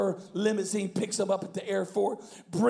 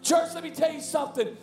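raised pitch, emphatic phrases with pauses; impassioned preaching; 7 semitones higher than usual. Real, not acted. Preacher telling church they are called, impassioned preaching. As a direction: drive the message home to the congregation. Preaches the point at full force.

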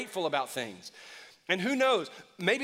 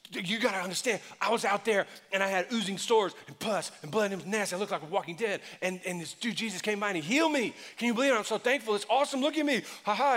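higher, laughing brag to the crowd; healed leper bragging, laughing by the end; excited bragging; 3 semitones higher than usual. Retold or imitated. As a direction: show off your healing, laughing.

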